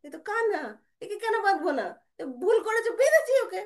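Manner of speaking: high, loud self-justification; defiant mother. Acted. Mother insisting it is only her Kanha, high defiant retort. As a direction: brush off the objection briskly and firmly.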